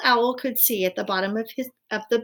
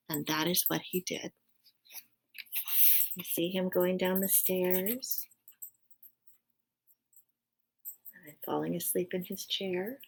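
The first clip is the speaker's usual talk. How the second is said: soft aside; 8 dB quieter than usual. Real, not acted.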